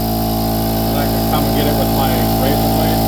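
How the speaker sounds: talking over a loud grinder; machine noise behind. Real, not acted. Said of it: "Sorry for the machine".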